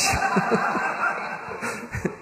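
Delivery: airy breath-only laugh; real little unvoiced giggle; voiceless giggle. Real, not acted. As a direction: laugh silently at your own joke. Laugh after acted quote.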